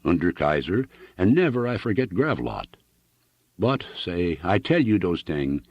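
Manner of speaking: put-on earnest heavy German accent; a homely German farmer, sincere and accented. Hooven explains his fatherland to Annixter, German accent.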